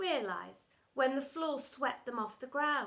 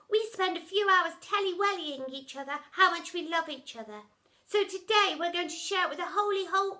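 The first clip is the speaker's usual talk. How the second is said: put-on higher gushing baby-talk voice; higher sugary lovey-dovey baby talk; soppy baby talk; 7 semitones higher, 6 dB louder than usual. A narrator's reading. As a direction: coo the rhymes like a besotted parent.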